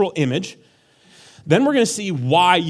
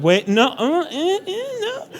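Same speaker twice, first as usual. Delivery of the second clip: flustered denial; 13 semitones higher, slower than usual. Retold or imitated. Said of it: "some of you are like".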